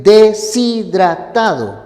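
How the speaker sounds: "deshidratado" silabeado con la s unida a la i, de-si-dra-tado; pronunciación correcta. Shown as a demonstration. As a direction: marca las sílabas de "deshidratado", de-si-dra. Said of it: "tú debes decir". ("deshidratado" dicho de-si-dra-tado, pausado)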